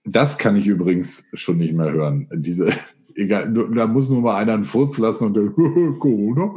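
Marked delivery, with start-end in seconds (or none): 5.5-6.5 laughing mockery. Real, not acted. Mocking overused Corona blame.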